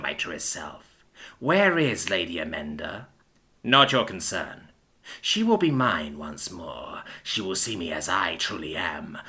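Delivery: low, slow, menacing villain voice; deluded villain. Acted. Villain to hero, low slow deluded menace. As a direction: speak low like a mad self-made dragon.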